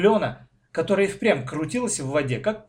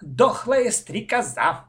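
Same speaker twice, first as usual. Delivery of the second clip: slow, higher, decided announcement; measured, determined little voice; firm; 8 semitones higher, 3 dB louder, slower than usual. A narrator's reading. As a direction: declare it with childish firmness. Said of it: "твёрдо сказала".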